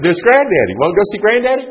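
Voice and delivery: lightly voiced parent coaxing a toddler; coaxing toddler talk. Parents urging the toddler toward granddad, sing-song coaxing.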